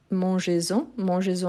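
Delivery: "mangez-en" said man-jé-zan, Z linking; "mangez-en" run together through a Z sound; liaison with Z. Shown as a demonstration. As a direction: link "mangez-en" with a clear Z. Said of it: "avec Z aussi".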